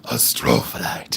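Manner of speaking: very low, slow monster voice; put-on man voice. Seller showing off a stone's name to viewers, monster-like man voice. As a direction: drop way down into a drawn-out monster rumble. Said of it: "It hurts my voice to sound like a man".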